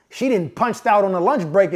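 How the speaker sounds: usual speaking voice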